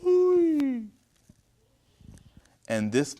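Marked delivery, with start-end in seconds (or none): 0.0-0.9 high, loud drawn-out ooh; shocked "ooh". Real, not acted. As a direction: react aghast with a high-pitched ooh. Reacting to son screaming at mother.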